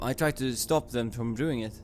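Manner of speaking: usual speaking voice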